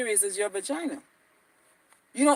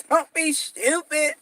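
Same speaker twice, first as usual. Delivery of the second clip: drawn-out catchphrase; 3 semitones higher, 3 dB quieter, slower than usual. Retold or imitated. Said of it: "That's how she say stupid".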